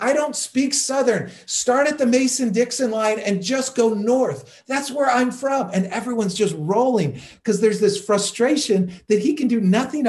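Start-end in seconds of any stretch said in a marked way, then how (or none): 0.0-5.7 higher, mock-defensive retort. Seinfeld answering Birmingham hecklers, mocking and pitched up. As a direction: tease the heckler, pitched up and a bit louder. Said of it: "like mocking the fact".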